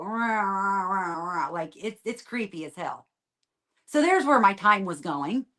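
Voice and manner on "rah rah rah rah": imitated growling rah. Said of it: "you hear my voice go".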